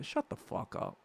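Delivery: breathy whispered dismissal. Real, not acted. Streamer whispering a dismissal at critics, under his breath. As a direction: hiss it under your breath. Whispered aside mid-rant.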